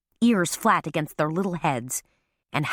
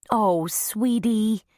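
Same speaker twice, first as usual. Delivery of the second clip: tender comfort; 5 semitones higher, much slower than usual. A narrator's reading. Carmela comforting tearful Baby.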